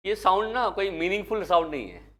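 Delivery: ordinary talk, nothing marked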